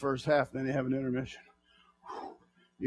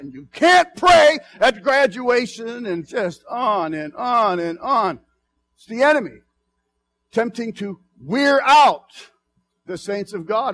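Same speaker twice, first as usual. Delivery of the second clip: preaching at full force; 6 semitones higher, 10 dB louder than usual. Acted. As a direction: rouse the congregation, hammering each phrase.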